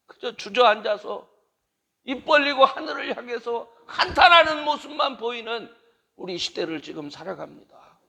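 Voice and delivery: high, loud impassioned prayer; fervent closing prayer. Real, not acted. The preacher prays over the gathered pastors, fervent and loud. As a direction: pray with full force for the pastors.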